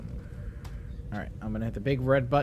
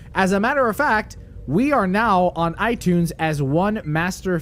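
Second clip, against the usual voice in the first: lively, projected host read; podcast outro read; 5 semitones higher, 4 dB louder, slower than usual. Acted. Host wrapping up the episode for listeners, polished outro read. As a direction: deliver the sign-off with punchy announcer emphasis. Reads the show's closing script.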